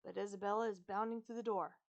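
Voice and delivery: plain reading voice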